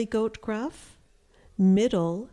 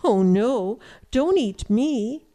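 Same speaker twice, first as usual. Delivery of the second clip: loud storybook plea; mildly alarmed goat, raised voice; pleading middle goat; 3 semitones higher, 6 dB louder than usual. A narrator's reading. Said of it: "said Middle Billy Goat Gruff".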